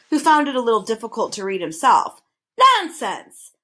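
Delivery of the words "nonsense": loud, high indignant bellow; indignant outburst. A narrator's reading. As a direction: bellow it as a dismissal. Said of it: "bellowed the math magician".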